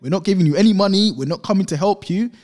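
loud, high imitated shouting; re-enacted shout in someone's face; aggressive shout. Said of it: "he's shouted in her face".

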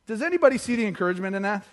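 normal talking voice

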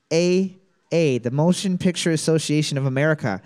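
usual speaking voice

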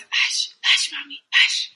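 whispered hush, then voiced; shushing whisper. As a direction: mimic a kid hushing mom. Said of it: "Landon's favorite thing to say".

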